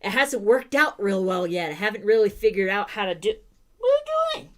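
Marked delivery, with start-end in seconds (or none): 3.8-4.5 surprised. Real, not acted. Sudden question to dog.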